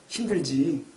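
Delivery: low, soft, sympathetic question; tender voice of the Lord. Acted. The Lord comforting struggling believers, tender. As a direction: ask it gently and low. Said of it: "이렇게 말씀하실 거예요".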